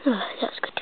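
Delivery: soft, satisfied whisper. Pretend. Doll finishes her punch, whispering contentedly. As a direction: breathe it out after a tasty sip.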